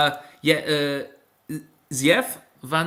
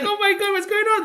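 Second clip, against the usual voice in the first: mock panic. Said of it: "you're like".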